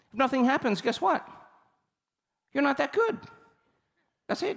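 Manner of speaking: high, mock-scolding reveal; blunt teasing. Telling the audience why nothing happened, high teasing bluntness. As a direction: poke fun at them with the hard truth.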